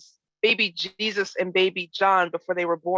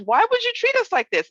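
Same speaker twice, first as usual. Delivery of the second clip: much higher, louder, frantic mother; Mary in a tizzy; 4 dB louder than usual. Acted. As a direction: let the voice fly up in alarm. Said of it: "read it like someone who is in a tizzy".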